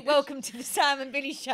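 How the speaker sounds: high Scottish-accented greeting. Shown as a demonstration. Host demonstrating the phrase for the guest, Scottish accent. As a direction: attempt a Scottish accent, laughing. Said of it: "so this is Scottish".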